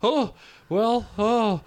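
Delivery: high, fretful worried voice; anxious little fluster; nervous. Retold or imitated. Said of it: "she goes".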